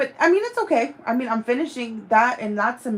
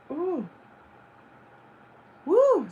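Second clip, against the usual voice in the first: excited whoop; 8 semitones higher than usual. Real, not acted. Hotel confirmation arrives.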